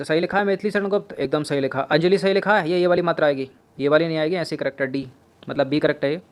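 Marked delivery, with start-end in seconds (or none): none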